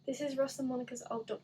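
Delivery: ordinary talk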